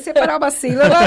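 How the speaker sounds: telling the story through big laughs; laughing loudly. Real, not acted. Laughing about childhood slipper beatings.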